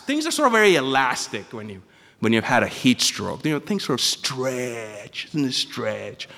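ordinary talk, nothing marked

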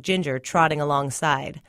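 ordinary delivery, nothing marked

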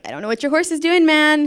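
mock-flustered rider; retold flustered protest. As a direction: blurt it out defensively, rising in volume. Retold defensive exchange with horse guide.

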